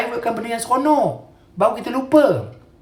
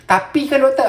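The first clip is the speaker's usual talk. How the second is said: mock objection, higher pitched; bantahan pesakit; 5 semitones higher, 4 dB louder than usual. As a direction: imitate a patient pleading "but, doctor". Mimics patients who keep objecting. (mengajuk pesakit membantah, nada tinggi)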